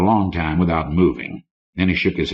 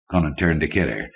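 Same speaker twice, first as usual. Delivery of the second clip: ordinary delivery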